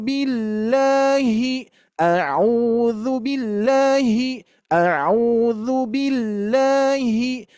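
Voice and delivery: slow straight tune, no swing; level recitation. Shown as a demonstration. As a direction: keep "billahi" level and even. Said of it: "Siap, lima kali". ("billahi" dibaca lurus tanpa ayunan)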